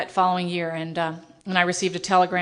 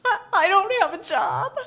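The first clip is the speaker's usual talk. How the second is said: retold crying; 11 semitones higher than usual. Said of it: "and she was crying".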